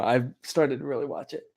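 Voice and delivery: ordinary delivery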